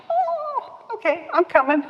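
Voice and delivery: sudden high meek oh; frightened newcomer complying, very high; meek high squeak. Retold or imitated. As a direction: squeak it out, instantly obedient. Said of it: "So it's like".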